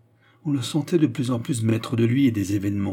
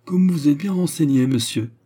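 high, loud mock-jovial remark; forced joking; 6 semitones higher, 6 dB louder than usual. A narrator's reading. German officer to Destour, feigning lightness, forced joking. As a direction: cover unease with a joke. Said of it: "affectant la plaisanterie".

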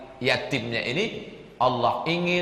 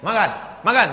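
mock loud call; 7 semitones higher, 6 dB louder than usual. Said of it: "kata kawan yang Medan ni".

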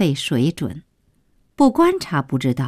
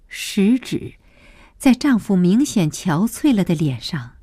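ordinary delivery, nothing marked

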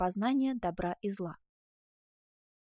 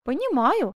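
quick, raised cry of understanding; lively exclamation; 5 semitones higher, 5 dB louder, slower than usual. A narrator's reading. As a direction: burst out with it. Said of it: "с живостью воскликнула". (живо, громче и выше)

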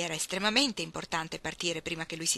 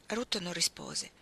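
ordinary delivery, nothing marked

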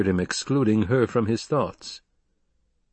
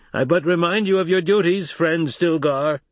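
high, raised voice, slowing on the name; challenger from above; 8 semitones higher, 4 dB louder than usual. Acted. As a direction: needle the leader from a distance.

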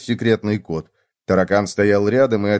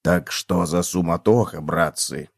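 low, softer, unhurried figure voice; cockroach's low voice; 7 semitones lower, 4 dB quieter, slower than usual. A narrator's reading. Cockroach asking the hare once more, low voice. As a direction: ask it low and easy. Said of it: "подмигнул зайцу и повторил".

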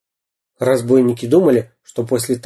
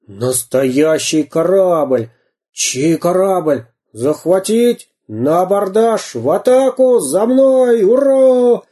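азартный боевой клич; 7 semitones higher, 3 dB louder, slower than usual. Acted. Пират рвётся в атаку.

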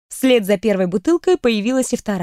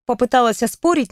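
ordinary delivery, nothing marked

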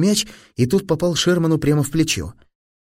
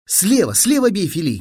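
high urgent coaching call; sudden call; 6 semitones higher than usual. A narrator's reading. Teacher suddenly calling instructions to his pupil. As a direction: call out the stroke suddenly, pitched up. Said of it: "крикнул вдруг учитель".

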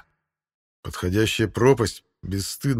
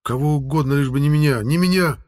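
fearful cry; 6 semitones higher, 4 dB louder than usual. A narrator's reading. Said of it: "взревел внутри Ивара страх".

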